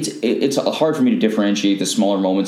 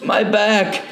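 much higher, strained cry of pain; hurt hero groan; 10 semitones higher than usual. Retold or imitated. Telling friends how Spider-Man lands on a dumpster, pained high wail. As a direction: whine it like a hero who just smashed his spine. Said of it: "and he's like".

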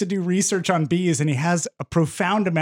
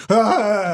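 mock scream; 4 semitones higher, 4 dB louder than usual. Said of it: "He's like".

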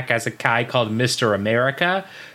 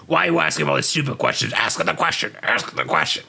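mock shouting, rushed then strained; Vince McMahon; faster than usual. Boss berates the test administrator, rushed mock shout. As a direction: imitate an exasperated boss yelling, no real volume. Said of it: "he's just shouting at the guy".

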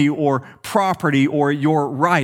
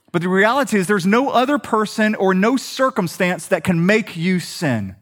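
ordinary talk

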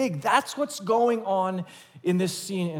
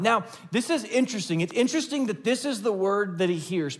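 ordinary talk

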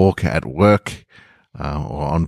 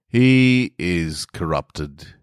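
grave verdict; much slower than usual. Acted. Doctor declares patient lost.

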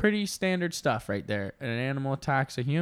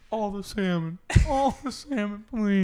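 higher comic bear voice; pleading bear; 7 semitones higher, 3 dB louder than usual. Acted. Condemned bear ordering his last meal, comic pleading. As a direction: beg for a last meal, pitched up. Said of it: "he's just like".

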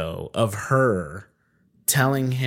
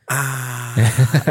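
louder cartoonish ah; Peter Griffin groan; 2 semitones higher, 5 dB louder than usual. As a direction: belt the cartoon dad's pained ah. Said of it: "that Peter Griffin thing".

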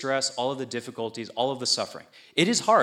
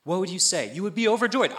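ordinary talk, nothing marked

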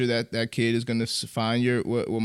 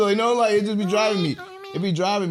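laughing; 8 semitones higher, 4 dB louder than usual. Real, not acted. Laughing about the struggle.

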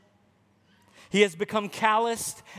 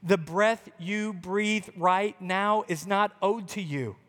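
ordinary talk, nothing marked

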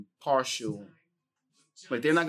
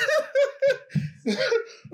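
high burst of laughter. Real, not acted. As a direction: shriek of laughter.

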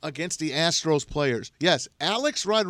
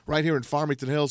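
raised, punchy radio host intro; show-opening announcer; 3 semitones higher, 4 dB louder than usual. Acted. Host welcoming everyone to the live streamcast, announcer style. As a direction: open the show with announcer energy. Opens the show over music.

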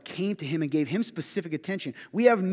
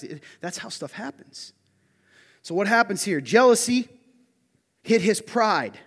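ordinary talk, nothing marked

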